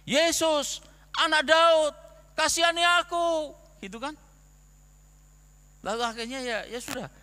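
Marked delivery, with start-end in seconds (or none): 0.1-3.6 loud, high desperate shout to Jesus; louder and higher, pleading shout for mercy; blind beggar crying out. Acted. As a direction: call out over the crowd, begging. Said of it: "Langsung dia teriak-teriak kan".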